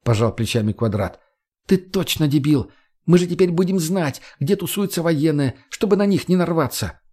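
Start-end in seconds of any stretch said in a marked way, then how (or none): 1.7-7.0 higher-pitched female character, scolding; young woman's excited retort; feisty Margo, exasperated. Acted. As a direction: explain it to an idiot, pitched up. Narrator voices Margo.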